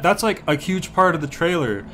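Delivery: ordinary delivery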